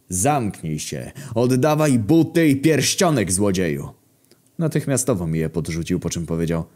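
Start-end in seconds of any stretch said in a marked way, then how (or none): 0.1-3.9 slow, deliberate order rising in pitch; acted indignant outburst; angry demand. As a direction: demand your stolen things back from a thief. Angry demand to the cornered thief.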